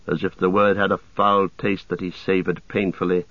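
ordinary delivery, nothing marked

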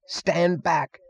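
high-pitched order without real volume; mock roar, high but held back; muted roar. A narrator's reading. Cuffy Meggs warning the room, restrained roar. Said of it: "roared Cuffy Meggs".

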